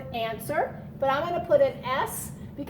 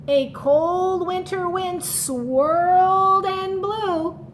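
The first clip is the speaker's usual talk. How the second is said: slow, higher, louder storybook narration; dramatic wind line; 6 semitones higher, 4 dB louder, much slower than usual. Acted. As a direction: draw out the swirling wind.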